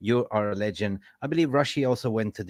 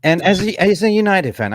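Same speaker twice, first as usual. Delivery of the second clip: rallying; 8 semitones higher, 6 dB louder than usual. Real, not acted. Rallying fans.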